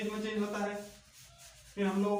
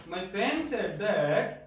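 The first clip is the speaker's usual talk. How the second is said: slow dictation; much slower than usual. Shown as a demonstration. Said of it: "इनको किन में change करना है".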